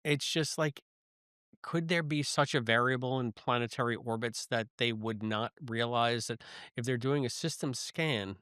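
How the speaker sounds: normal talking voice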